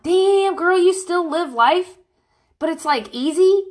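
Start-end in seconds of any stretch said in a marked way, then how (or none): 0.0-2.0 put-on high, loud, slowed "damn, girl"; mock disbelief. Retelling her reply to a friend, in mock disbelief. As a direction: act floored by a friend's luck. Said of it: "And I'm like".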